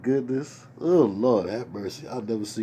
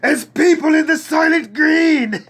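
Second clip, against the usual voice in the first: anguished movie-hero shout, high and slow; full-volume melodramatic cry; Charlton Heston; 13 dB louder than usual. Acted. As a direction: scream it out as a drawn-out film impression. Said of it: "Let me do my Charlton Heston".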